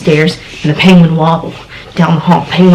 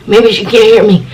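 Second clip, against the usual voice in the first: ordinary delivery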